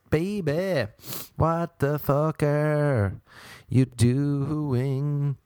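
usual speaking voice

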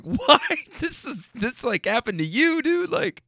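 high, breathless laughing question; laughing incredulous. Real, not acted. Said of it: "I was laughing in the moment".

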